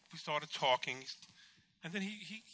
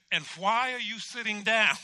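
raised, louder incredulous question; indignant challenge; 6 semitones higher, 7 dB louder than usual. Retold or imitated. Patient challenging the doctor who sat down, indignant. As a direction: demand an answer, pitched up.

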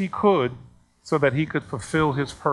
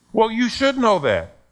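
retold angry scolding; 8 semitones higher, 4 dB louder than usual. Acted scolding by angry parent.

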